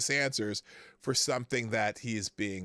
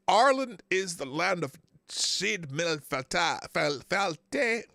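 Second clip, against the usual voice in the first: Irish accent, pitched up and badly faked; terrible Irish accent; 4 semitones higher, 4 dB louder, slower than usual. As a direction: attempt an Irish accent and stumble through it. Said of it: "I can't do an Irish accent".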